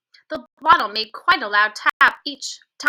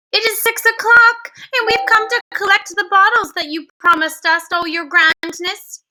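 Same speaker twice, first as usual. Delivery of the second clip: put-on higher, timid old witch voice; lightly voiced meek old hag; 7 semitones higher, 4 dB louder than usual. A narrator's reading. Ancient witches answering through the bedroom door, meekly.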